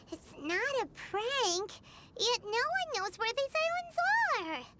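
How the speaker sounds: ordinary talk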